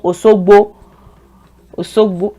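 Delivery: Osogbo said with a plain s; S instead of SH. Shown as a demonstration. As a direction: say Osogbo as "aw-saw-gbaw". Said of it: "they use C instead".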